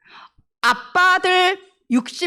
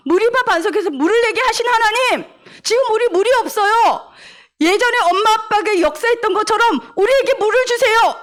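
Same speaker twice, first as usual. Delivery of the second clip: higher, earnest pleading prayer; faithful prayer; 8 semitones higher than usual. Shown as a demonstration. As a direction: plead with God trustingly for water. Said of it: "엄마 아빠가 믿음의 말을 해야 될 거 아니야". (간절하게 믿음으로 드리는 기도)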